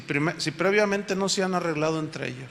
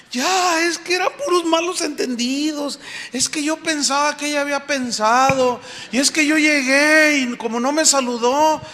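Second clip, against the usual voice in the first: gushing reconciled woman; 7 semitones higher than usual. Retold or imitated. Reconciled woman explains herself.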